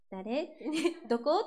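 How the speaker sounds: 誰 and どこ each rising at the end; Fukuoka intonation. Shown as a demonstration. Singer quizzes viewers in dialect, 誰 and どこ rising. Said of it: "ちょっと上がるところが多いんですよね".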